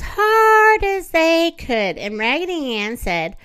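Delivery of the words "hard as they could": strained, slow squeeze with effort; straining. Acted. Narrator strains as dolls squeeze the pebble, effortful.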